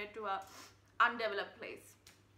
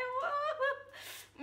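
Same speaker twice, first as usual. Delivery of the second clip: high giggle; 5 dB louder than usual. Real, not acted. Laughing after thanking lady.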